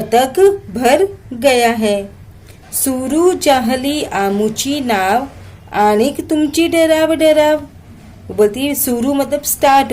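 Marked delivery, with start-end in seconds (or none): 2.7-7.6 poem recitation. Shown as a demonstration.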